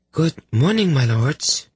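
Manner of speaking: very high, sweetly chirpy female voice; bright young lady. A narrator's reading. Lady greeting two hungover lords, bright chirp. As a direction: chirp it brightly, high above your own voice. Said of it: "she said brightly".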